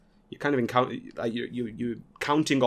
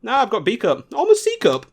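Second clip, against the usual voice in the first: much higher, shrugging it off; breezy and unbothered, pitched up; Mary shrugging off the cup joke, higher; casual Mary; 8 semitones higher, 3 dB louder than usual. Retold or imitated. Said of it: "But she's like".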